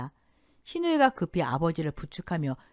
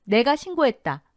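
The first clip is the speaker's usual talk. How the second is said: raised, louder taunt; scornful confession; sneering; 6 semitones higher, 6 dB louder than usual. A narrator's reading. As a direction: say it mockingly, with triumph. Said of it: "비웃으며 말했습니다".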